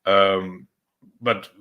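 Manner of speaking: ordinary delivery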